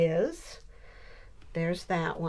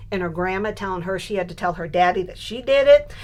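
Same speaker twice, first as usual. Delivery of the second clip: ordinary talk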